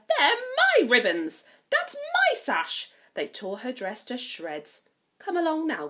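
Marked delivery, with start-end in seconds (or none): 0.1-2.9 loud, high, slow jealous shriek; shrieking stepsisters. Acted. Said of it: "The stepsisters shrieked". 5.2-5.9 commanding stepmother. Acted. Stepmother calls girls.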